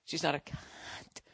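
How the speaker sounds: voiceless, hushed stage whisper. Retold or imitated. Comic confiding a crude insult to listeners, whispered. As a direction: whisper it, drawing the word out.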